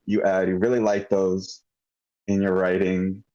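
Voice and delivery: ordinary talk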